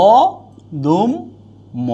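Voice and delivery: অদম্য drawn out slowly; slow demo pronunciation. Shown as a demonstration. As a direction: stretch অদম্য for students. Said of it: "teacher demonstrates pronunciation".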